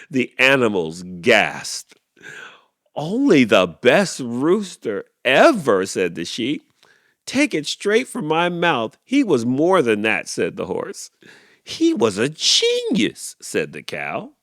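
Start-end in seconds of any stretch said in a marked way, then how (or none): 2.9-5.9 loud, slow proud boast; proud sheep. A narrator's reading. Sheep boasting about Larry, loud slow praise. 7.2-10.3 loud, high eager boast; proud horse. A narrator's reading. Horse bragging about the late rooster, loud and high. 11.6-13.4 high, loud, slow awe; awed cow. A narrator's reading. Cow swooning over Larry, high slow awe.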